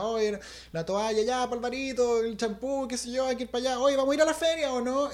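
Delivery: high-pitched household chatter; bustling relatives. Retold or imitated. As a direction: call out errands like busy aunts. Voicing relatives bustling about the house.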